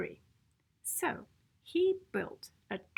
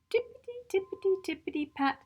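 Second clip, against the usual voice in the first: fast, light and high tiptoeing; quick tiptoe patter; 8 semitones higher, much faster than usual. A narrator's reading. Narrator to children, bunnies creeping quickly in. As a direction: skip quickly on the tips of the words.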